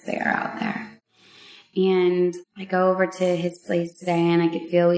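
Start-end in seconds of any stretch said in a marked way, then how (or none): 0.0-0.9 breathed softly, almost no voice; secretive whisper to the ladies; hushed whisper. Real, not acted. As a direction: let it fall to a whisper. Repeated phrase, hushed.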